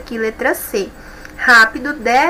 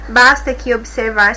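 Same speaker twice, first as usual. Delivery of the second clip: normal talking voice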